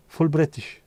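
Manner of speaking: imitated Polish accent, rolled r; English in a put-on Polish accent. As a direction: play the Polish fella. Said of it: "Polish fella".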